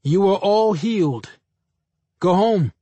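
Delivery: high, projected proclamation; intercom announcement. A narrator's reading. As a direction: announce it to the whole hospital. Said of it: "I spoke into it, and my voice flew all over".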